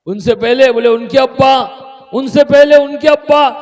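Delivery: high, loud questioning climb; insistent back-and-forth. Acted. Insistent questioning exchange in the story.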